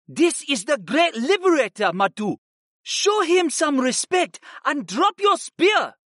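a guard scolding his comrade. A narrator's reading. Second guard voiced in story.

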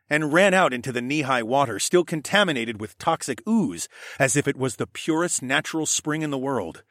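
usual speaking voice

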